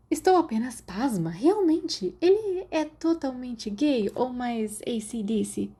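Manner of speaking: put-on high, lively girl's voice; a curious schoolgirl pressing for gossip; Marlene's voice. A narrator's reading. (voz aguda de garota curiosa)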